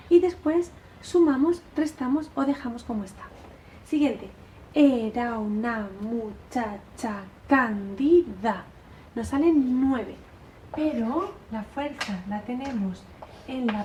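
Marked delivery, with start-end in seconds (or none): 4.7-8.6 slow scanning of the verse; recitado en marcha. Shown as a demonstration. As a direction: stretch out every syllable as if marching. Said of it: "como si estuvierais haciendo marcha". (recitando lento como una marcha silábica)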